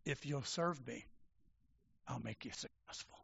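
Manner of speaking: low, soft, sinister threat; a hint of the devil whispering threats; menacing devil voice. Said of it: "He said".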